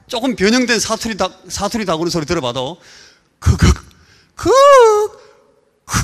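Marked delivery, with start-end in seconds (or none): 3.4-6.0 loud clipped keu-keu croaks; dialect rooster cry. Shown as a demonstration. Preacher imitating a foreign rooster, loud short croaks. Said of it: "이렇게 웁니다, 닭이".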